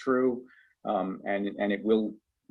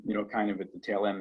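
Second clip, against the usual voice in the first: ordinary delivery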